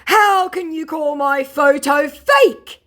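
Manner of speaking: high, loud, drawn-out mock wail; outraged mock scammer. Scammer defending his photo, hammed-up outrage. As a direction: ham up the scammer's wounded protest. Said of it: "said my scammer".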